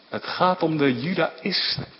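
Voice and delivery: ordinary delivery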